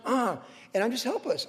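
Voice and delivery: ordinary delivery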